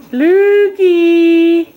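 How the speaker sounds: loud, high, drawn-out name call; singsong call. Real, not acted. Calling baby in drawn-out singsong.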